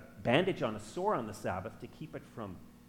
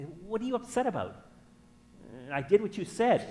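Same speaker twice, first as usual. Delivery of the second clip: higher-pitched, mock-puzzled husband; defensive protest; 8 semitones higher, 4 dB louder than usual. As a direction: act out an innocent husband protesting. Acts himself protesting to upset wife.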